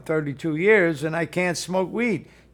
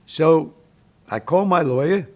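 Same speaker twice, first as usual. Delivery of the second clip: normal talking voice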